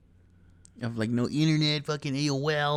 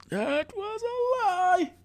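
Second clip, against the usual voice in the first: very high, drawn-out falsetto; slow sing-out far above normal pitch; mock falsetto; much slower than usual. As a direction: stretch it out in a piercingly high comic voice. Mock outburst after racism claim.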